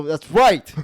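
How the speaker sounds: loud burst on the win; mock triumphant yell. Acts gambler's high five.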